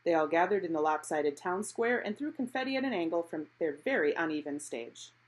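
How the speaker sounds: ordinary delivery, nothing marked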